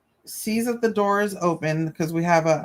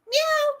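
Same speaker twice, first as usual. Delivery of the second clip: a tiny, much higher imitated meow; mock cat meow. Imitating the cat's reply.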